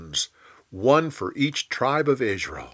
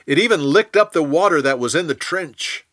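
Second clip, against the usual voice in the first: ordinary delivery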